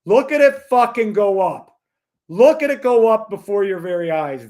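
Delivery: loud, high-pitched insistence; crowing loudly at the viewers; emphatic exclamation. Real, not acted. Urging viewers to watch market rise.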